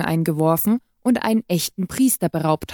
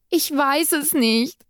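desperate cry; 7 semitones higher, slower than usual. A narrator's reading. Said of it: "rief ich".

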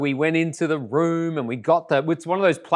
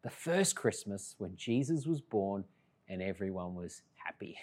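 put-on much softer, hushed little girl's request; a soft, wistful child's plea; daughter's soft plea; 6 semitones lower, 11 dB quieter than usual. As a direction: lightly voice a small child asking earnestly.

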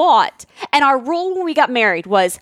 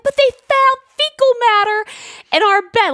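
high, pleading excuse; mock-frantic justification; desperate plea; 11 semitones higher than usual. Desperate defense retold.

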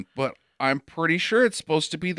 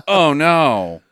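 mock bad acting; 2 semitones lower, 5 dB louder than usual. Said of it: "This is the line of the movie for me".

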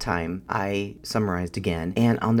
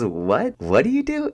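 rising, much higher and louder astonished question; the therapist in disbelief, voice jumping up; 7 semitones higher, 4 dB louder, slower than usual. Retold or imitated. Therapist asking the young client how she copes, in loud, high astonishment. Therapist amazed by her answer.

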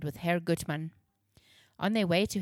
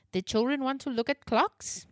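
high, friendly German clockmaker; German accent; 6 semitones higher than usual. Acted. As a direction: ask kindly with German accent. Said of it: "Herr Gutmann had a heavy German accent".

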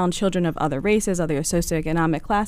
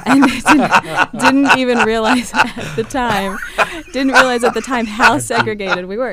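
laughing; 4 semitones higher, 7 dB louder, slower than usual. Real, not acted. Laughing through her memory.

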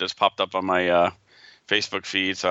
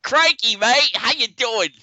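high, loud hammed-up Australian "Crikey"; mock Australian exclamation; Australian accent; 12 semitones higher, 4 dB louder, slower than usual. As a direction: shout "Crikey" like a cartoon Aussie. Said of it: "We apologize for [name]'s accent".

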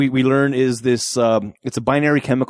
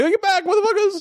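much higher threatening yell; mock yell. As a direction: warn the gang off, hammed-up. Zeus pulls the gun.

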